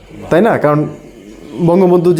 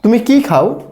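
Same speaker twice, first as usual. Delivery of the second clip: what-question with কি pushed high; stress on কি; 8 semitones higher, slower than usual. Shown as a demonstration. Teacher asking student what they eat, stress on কি. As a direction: hit কি hard, asking what is eaten. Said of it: "জোর দিয়েছি কি এর উপরে".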